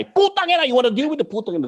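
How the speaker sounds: teacher chasing the big soldier, hammed-up yell; mock battle cry. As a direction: charge in yelling a playful threat. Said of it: "Pulls out the balisong and chases the guy".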